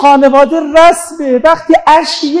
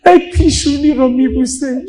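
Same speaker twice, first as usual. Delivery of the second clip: ordinary talk, nothing marked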